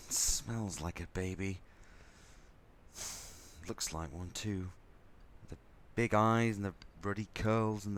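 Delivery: put-on quietly taken aback by the baby; softened hush. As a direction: let the anger melt as you look at her. First holding the baby.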